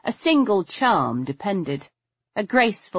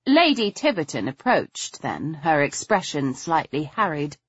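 plain reading voice

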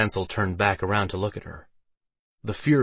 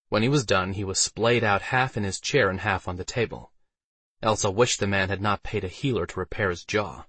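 ordinary delivery

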